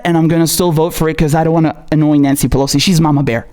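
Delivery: ordinary talk, nothing marked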